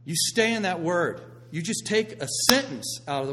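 loud, driving preacher storytelling; preaching at full force. Acted. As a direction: sell the picture big to the congregation. Preaching peak about trusting God.

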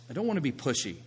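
normal talking voice

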